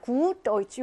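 "Gut" with a long, held u; long vowel. Shown as a demonstration. Teacher shows students how long it goes, drawn-out "Gut". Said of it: "이렇게 길게 합니다".

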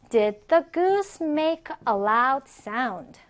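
very slow, word-by-word question; deliberate, carefully spaced words; slow model reading. Shown as a demonstration. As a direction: model the question slowly for learners. Teacher's first, slower model question.

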